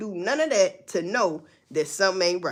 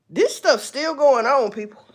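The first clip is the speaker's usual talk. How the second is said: emphatic warning; 4 semitones higher, 7 dB louder than usual. Real, not acted. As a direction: hammer it home to the audience.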